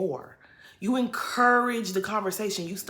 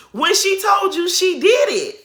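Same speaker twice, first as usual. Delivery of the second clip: exasperated shout; 12 semitones higher, 10 dB louder than usual. Real, not acted. Ranting at Monique on TV.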